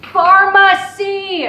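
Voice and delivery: drawn-out frustrated shout of Pharmacy; yelled menu choice. Acted. Mom shouting at the automated phone menu, loud and slow. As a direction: bellow it slowly at the phone menu.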